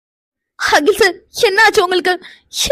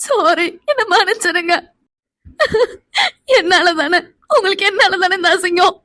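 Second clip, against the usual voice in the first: sobbing apology; crying, begging forgiveness; tearful apology. Acted. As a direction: blame yourself through tears. Crying wife apologises to husband.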